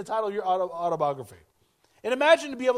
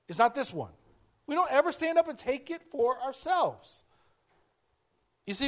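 ordinary delivery

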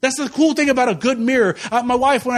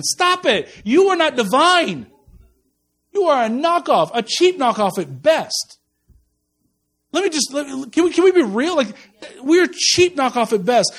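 raised, emphatic pulpit delivery, driving each line home; full-force preaching; 4 semitones higher than usual. Acted. Preacher telling the congregation they're knockoffs, in full-force preaching. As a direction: preach it hard, pounding the point.

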